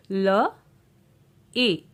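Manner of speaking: ल and ए said as separate, drawn-out sounds; sounding out letters. Shown as a demonstration. Teacher showing long A to learners, sounding out ल, ए. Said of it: "ए का long sound".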